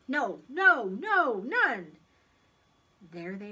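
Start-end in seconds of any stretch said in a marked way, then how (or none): 0.1-2.0 loud, higher string of refusals; firm, punched-out self-denial; emphatic refusal. A narrator's reading. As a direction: talk yourself out of it forcefully. Paul firmly resisting his thought.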